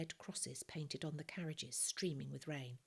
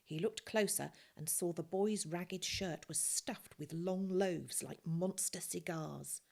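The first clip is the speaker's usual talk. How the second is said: ordinary delivery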